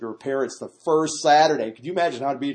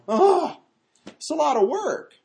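high-pitched groaning complaint; exasperated mom; 14 semitones higher, 4 dB louder, slower than usual. Acted. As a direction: moan it like an overworked hostess. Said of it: "the mom's sitting there going".

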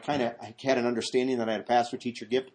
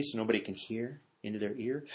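hushed, much softer voice; talking quietly; 3 semitones lower, 11 dB quieter than usual. Shown as a demonstration. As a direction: drop it so nobody else can hear. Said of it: "talking quietly".

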